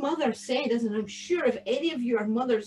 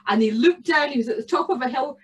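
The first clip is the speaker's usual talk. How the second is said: usual speaking voice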